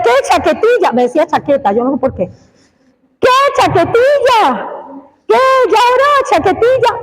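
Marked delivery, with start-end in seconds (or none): 0.0-0.9 a mocking schoolmate's jeer, higher; mocking classmate. Preacher imitating a classmate mocking him at school, higher jeer. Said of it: "Lo primero que hacía era". 3.2-7.0 mocking classmate.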